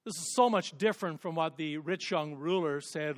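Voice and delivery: ordinary talk, nothing marked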